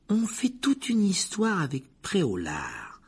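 high, slow, solemn put-on voice; grave. A narrator's reading. Percy tries to console Harry, in grave tones. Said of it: "dit Percy d'un ton grave".

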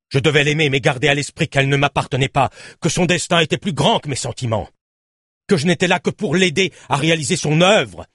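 justification passionnée, voix plus haute; aveu fervent. A narrator's reading. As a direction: plaide ta cause avec ferveur. Aveu emporté du président. (aveu passionné)

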